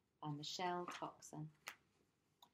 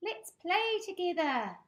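very high, loud kitten; cat voice; 12 semitones higher, 11 dB louder than usual. Acted. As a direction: coax like a small cat. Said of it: "meowed Pumpkin to the birds".

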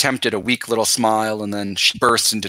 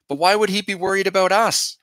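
high and pleading, voice lifted on would; a scared girl asking her brother; worried sister, pitched high; anxious question; 9 semitones higher than usual. A narrator's reading. Frightened sister asks.

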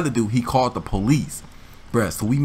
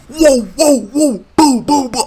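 high, loud whoa-boo sound effects; acorn impact noises; 13 semitones higher, 8 dB louder, slower than usual. Retold or imitated. Teller to audience, acorns smashing the car, loud mock impacts. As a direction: fire off the acorn hits as noises.

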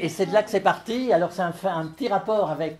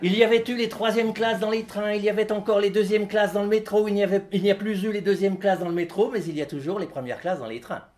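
fast, even run without pauses; rapid rattle; 3 semitones higher than usual. Acted. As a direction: rattle through it on one level tone, dropping at the end. Rattles through digression in reading.

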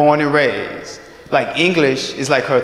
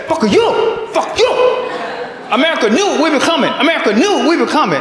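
high, indignant mock Japanese accent; outraged Japanese-accented girlfriend voice; 12 semitones higher than usual. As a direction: curse in an exaggerated Japanese accent, pitched way up. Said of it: "I do the accent because she's from Japan".

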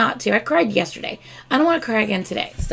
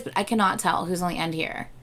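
usual speaking voice

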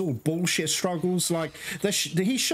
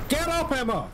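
much higher, urgent cry to get up; urgent call; 8 semitones higher than usual. Real, not acted. Hunter urging his fallen partner up, high urgent call. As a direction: yell at her to get up.

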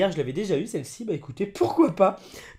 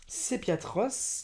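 ordinary talk, nothing marked

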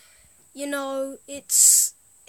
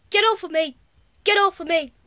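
mock yell; 4 semitones higher, 7 dB louder, faster than usual. Yelling at planes.

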